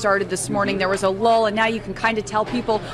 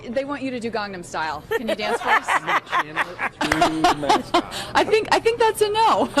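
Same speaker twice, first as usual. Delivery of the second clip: laughing reporter; 6 semitones higher than usual. Real, not acted. Reporter laughing at mascot request.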